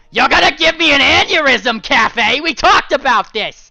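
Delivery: high, loud exasperated yell; husband yelling at his wife; angry shout. Acted. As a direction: shout in disbelief.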